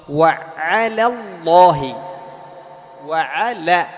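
slow, loud "وعلى الله", light la then heavy Lla; thin lam in ala. Shown as a demonstration. Teacher shows students the key point, slow "وعلى" with light lam. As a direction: recite "وعلى" with a thin l, slowly. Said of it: "لام على تحافظ على ماذا؟".

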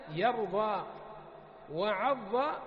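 normal talking voice

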